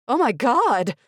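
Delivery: high shocked cry; waitress horrified; scream. A narrator's reading. Waitress screaming at the seizure, high shock. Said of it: "the waitress screamed".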